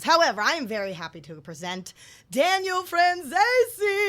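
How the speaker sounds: loud, sing-song high introduction; grand announcement. Real, not acted. Cohost presenting the guest with a flourish. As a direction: announce him like a showman.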